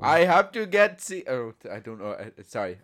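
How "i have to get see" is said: much higher, louder attempt at an Austrian accent; a wildly off Austrian Arnie accent; failed Arnie accent. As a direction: lurch into a botched Arnold Schwarzenegger voice. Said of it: "I don't know where I was going with that accent".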